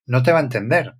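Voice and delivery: ordinary talk, nothing marked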